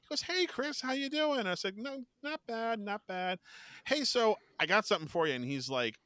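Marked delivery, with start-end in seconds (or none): 0.2-1.5 high-pitched friendly hello; acted cheery greeting. Actor greets approaching fan, high and friendly. 1.8-5.4 high, feigned-innocent small talk; mock casual. Fan about to produce tape, high innocent voice. Acted innocent lead-up to prank.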